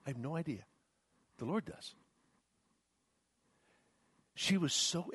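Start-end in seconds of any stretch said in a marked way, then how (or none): 0.1-1.9 soft, calm answer; gentle reply. Retold or imitated. Pastor answering the angry wife, softly. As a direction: answer her quietly. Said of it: "I said".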